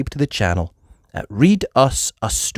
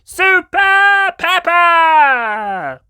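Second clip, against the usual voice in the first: high, drawn-out cheering shout; crowd cheer; 8 dB louder, much slower than usual. A narrator's reading. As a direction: cheer as the whole class. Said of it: "Everyone cheered".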